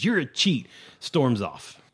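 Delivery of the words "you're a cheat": angry accusation. Acted. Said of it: "slams his hand on the table and says".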